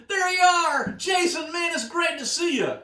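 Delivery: high, loud Southern shout of greeting; southern-accented yell. Retold or imitated. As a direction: holler a hearty hello in a Southern accent. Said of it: "he had sort of a southern accent".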